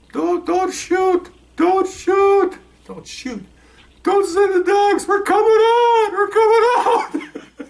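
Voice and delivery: very high, drawn-out, frightened cries of surrender; panicked pleading. Retold or imitated. Burglars inside begging the police not to shoot, high and panicked. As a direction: beg them not to shoot.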